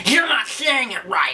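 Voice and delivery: nasal scolding fanboy voice; mocking Japanophiles. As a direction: whine it like an offended purist. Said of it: "ten Japanophiles right now going".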